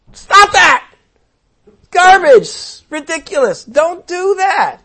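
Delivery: high, loud, indignant shout; exasperated outburst. Real, not acted. Preacher shouting down rapture sermons, outraged and loud. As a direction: blast the false teaching down.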